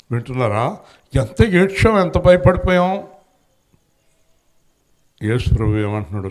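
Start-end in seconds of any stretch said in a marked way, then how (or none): 1.1-3.1 mock distress. Echoing the parents' distress.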